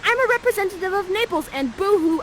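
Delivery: high, loud, gushing dude; excited prince. Acted. Ferdinand meeting Miranda, in a high excited voice. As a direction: blurt it out, thrilled. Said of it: "Ferdinand is like".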